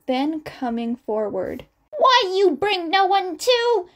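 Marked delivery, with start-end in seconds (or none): 2.0-3.9 put-on higher, louder old woman's scold; angry scolding. A narrator's reading. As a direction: scold them like a cross grandmother. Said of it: "Chiyo's angry scolding".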